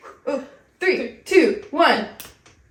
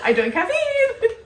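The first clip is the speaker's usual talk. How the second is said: laughing; 12 semitones higher, slower than usual. Real, not acted. Giddy confession after caffeine.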